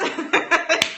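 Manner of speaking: bright burst of laughter; laughing. Real, not acted. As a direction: laugh at your own joke. Laughs at her own joke.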